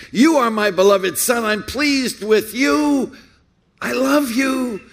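high, ringing proclamation over the congregation; Father's declaration. Acted. Preacher voices the Father on the mount, declaring aloud. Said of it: "the voice of the Father declaring".

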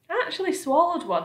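earthworm boasting, pitched high; proud declaration. A narrator's reading. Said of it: "the earthworm declared proudly".

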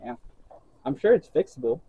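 normal talking voice

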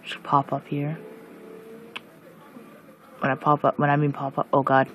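ordinary talk, nothing marked

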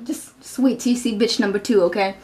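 ordinary talk, nothing marked

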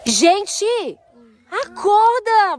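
loud, drawn-out wake-up call. Real, not acted. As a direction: call them awake, stretched and loud. Waking sleeping kids.

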